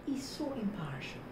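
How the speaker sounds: whispered, voiceless He's so impartial. Shown as a demonstration. Teacher repeating the word for learners, whispered. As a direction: breathe it out as a whisper.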